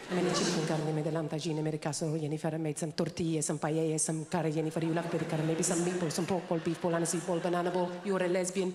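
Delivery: mild Spanish mother pleading; Spanish mum voice. Retold or imitated. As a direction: pile on the food like a doting Spanish mum. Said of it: "conversation always goes like this".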